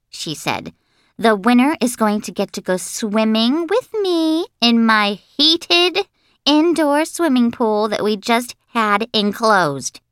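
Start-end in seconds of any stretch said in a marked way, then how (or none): 1.2-10.0 high, drawn-out, gushing schoolgirl; high-pitched and dramatic, stretching it out; excited bragging girl. Acted. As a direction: build suspense like a show-off kid.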